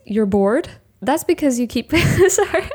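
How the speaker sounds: reading, then cracking up; can't hold the laugh in; breaking into laughter. Real, not acted. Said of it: "See if I can get through it without laughing".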